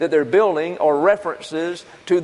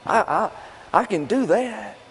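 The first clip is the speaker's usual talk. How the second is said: higher, breezy voice of an overconfident believer; mock eager listener; 5 semitones higher than usual. Preacher voicing a listener who thinks forgiving is easy, higher and breezy. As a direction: brush it off like it costs nothing, voiced higher. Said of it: "You say".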